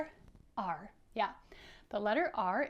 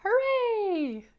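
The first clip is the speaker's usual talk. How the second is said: much higher, loud cheering "Hooray!"; excited cheer; 12 semitones higher, 10 dB louder than usual. Acted. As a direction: cheer it out like a thrilled rabbit. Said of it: "Rabbit sees the plane and says".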